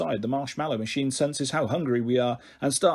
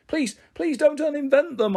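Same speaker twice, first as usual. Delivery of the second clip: pleading; 12 semitones higher than usual. Acted. Pleading to save sharks.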